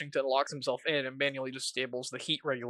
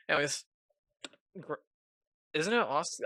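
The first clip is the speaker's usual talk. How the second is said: ordinary talk, nothing marked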